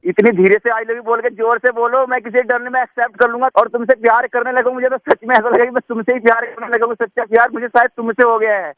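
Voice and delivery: high, eager romantic begging; lovestruck pleading. Acted. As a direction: beg her to say it again. (दीवाने की तरह गिड़गिड़ाते हुए)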